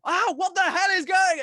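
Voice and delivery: high, loud startled yell; hyperactive scream. Retold or imitated. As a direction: screech it out in a panic. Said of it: "I'm always like".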